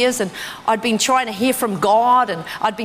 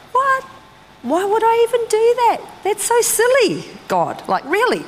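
mock outrage, pitched way up; incredulous; 11 semitones higher than usual. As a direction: exclaim in shocked disbelief at God. Disbelief at God's instruction.

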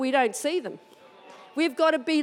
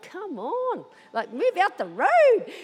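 impatient; 6 semitones higher, slower than usual. Retold or imitated. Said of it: "I'm thinking, like".

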